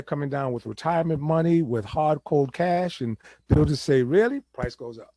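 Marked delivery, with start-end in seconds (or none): 4.0-4.4 a high, rising, incredulous "Really?"; mock surprise. Builders eyeing cash buyers, mock surprised.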